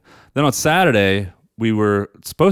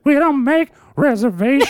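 put-on high, sing-song old lady; 4 dB louder than usual. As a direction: lilt it like a prim old woman. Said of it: "Is she singing?".

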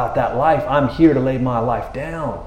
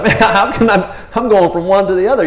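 higher, louder baffled; 6 semitones higher, 6 dB louder than usual. Retold or imitated. Peter baffled at being called Satan, higher and louder. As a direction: cry out at the swing.

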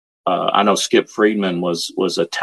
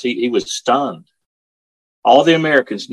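usual speaking voice

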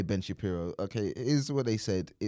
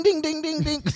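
high loud ding-ding bursts; gunshot noise imitation; 5 dB louder than usual. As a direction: fire off the gunshot sounds high. Imitating gunshots outside window.

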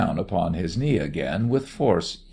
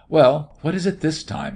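louder, higher, sharp question; 7 semitones higher, 4 dB louder than usual. A narrator's reading. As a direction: ask it sharply, with impatience. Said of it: "he asked sharply".

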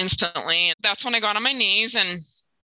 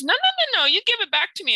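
high, emphatic no-no-no; insistent refusal; 12 semitones higher than usual. Retold or imitated. Dean refusing the thank-you, demanding the letter back, high and insistent. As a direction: playfully insist she hand it back.

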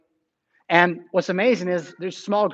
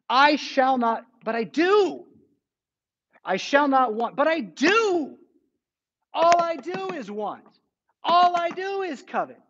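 anguished pleading; 7 semitones higher, slower than usual. Acted. Anguished confession to God.

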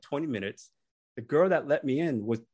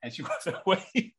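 ordinary talk, nothing marked

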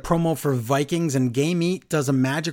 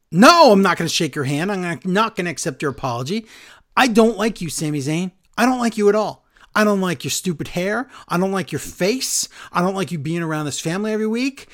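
higher, indignant rant, imitating a wrestler; angry rejection; 5 semitones higher, 4 dB louder than usual. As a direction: act out a fed-up wrestler's tirade.

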